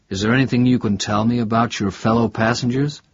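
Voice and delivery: usual speaking voice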